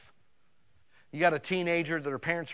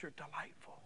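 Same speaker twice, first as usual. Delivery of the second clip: soft, whispered affection; hushed, tender murmur; doting grandpa; 15 dB quieter than usual. Acted. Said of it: "But a good grandpa just says".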